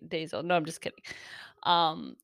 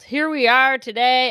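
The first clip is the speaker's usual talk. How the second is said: loud, bright show opener; announcer-style greeting; 5 semitones higher, 10 dB louder than usual. Shown as a demonstration. Vlogger redoing her opening line for viewers, loud. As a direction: belt out the catchphrase like a host. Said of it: "did I already say here we are today".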